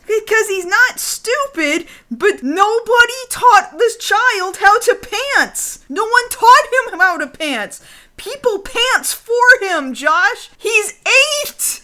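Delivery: high-pitched exasperated comic rant; incredulous, pitched well up; mock outrage. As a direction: plead the child's case in exaggerated disbelief. Ranting in mock outrage over pants.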